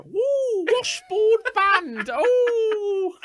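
high, loud hammed-up yelp; over-the-top mock whoop; mock excited shout. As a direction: ham up the cheesy movie cry. Mocking a movie line, shouted.

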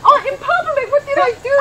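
high, loud panic through laughter; retold panic. Speaker recalling a slap in public, laughing mock panic. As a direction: laughingly re-enact a panicked outcry. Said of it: "I was in panic".

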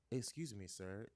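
quiet, low, coy question; soft teasing. Retold or imitated. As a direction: say it softly, playing innocent. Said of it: "and I go".

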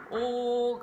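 オー held long as the main part of the vowel; a teacher's slow model O. Shown as a demonstration. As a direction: stretch オー with rounded lips. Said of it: "オーが九割".

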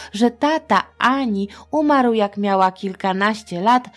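usual speaking voice

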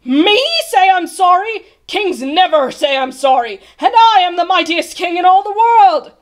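loud, indignant royal outrage; bellowed. A narrator's reading. The mightiest king refuses to say sorry, bellowing. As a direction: bellow it as the offended monarch. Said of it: "he bellowed".

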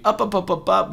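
high chant-like up, up, up; excited cheer. Real, not acted. Vlogger cheering a stock rally, high and excited.

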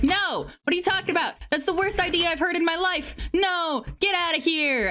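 father yelling no, pitched high; mock outraged dad, high and insistent; a parent shouting down the idea; angry father. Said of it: "Her father was like".

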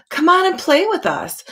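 high sing-song call; calling fish friends. Acted. Fish friends calling Rainbow Fish over to play, high and bright. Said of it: "they would call".

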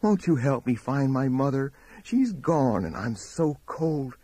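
put-on little girl pleading in a high voice; clear, high child's plea; high, clear, sweet. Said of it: "clear voice as sweet as morning mist".

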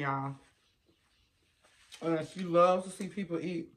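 words between bites; talking while chewing. Real, not acted. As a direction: mouth partly full. Seen 1: Holds wing after bites; lips pursed, cheeks working between phrases.

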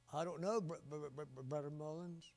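mild retold stammer on br-, br-; halting, stumbling over the word Brother; quiet imitated stutter. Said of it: "she stuttered".

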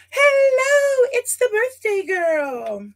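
high, bright sing-song hello; sing-song greeting. Pretend. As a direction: greet like a cheery character. Greeting a stamp character, sing-song.

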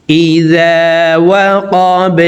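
slow, chanted Arabic recitation; Quran recitation. Shown as a demonstration. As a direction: draw each word out in tajweed style. Recites the verses.

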